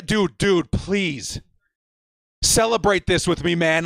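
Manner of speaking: usual speaking voice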